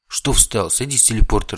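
loud annoyed yell; irritated shout. Acted. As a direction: snap at him to move. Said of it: "раздражённо крикнул Кельмир". (раздражённый крик)

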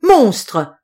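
loud, sharp cry of alarm; outcry. A narrator's reading. Rosalie crying out at the mouse, loud. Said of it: "s'écria Rosalie".

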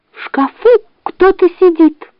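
high, anxious fox voice; whisper-urgent fox warning. A narrator's reading. Fox warns bear.

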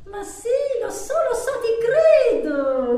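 high, swelling protest of belief; insistent reassurance. Acted. Insists she believes her lover. (rassicurazione insistente e accorata)

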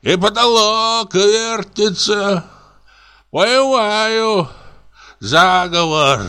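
put-on high, slow, drunken rant about the room; drunk comic raving. A narrator's reading. Drunk comic rants at the spinning room, high and slow. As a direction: reel through it like a paranoid drunk.

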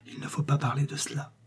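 soft breathless whisper; wife whispers to her husband, hushing him gently; murmured. A narrator's reading. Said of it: "murmura-t-elle".

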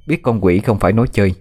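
ordinary delivery, nothing marked

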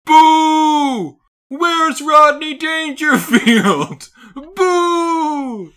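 high, loud booing heckler; booing audience. Retold or imitated. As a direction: yell like an angry crowd. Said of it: "they were sitting there going".

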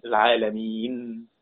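العالمين with the final nun held silent, no vowel after it; slow, drawn-out العالمين ending in a bare sukun; quiet demo of stopping on العالمين; pausal stop on final nun. Shown as a demonstration. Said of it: "ونقف على رأس الآية نقول إيه".

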